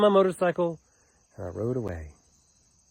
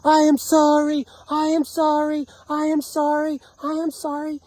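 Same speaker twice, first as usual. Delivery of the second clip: screamed apology; 13 semitones higher, 4 dB louder than usual. Retold or imitated. Bowing on hands and knees to the neighbors, re-enacted screamed apology. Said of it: "screaming really loud".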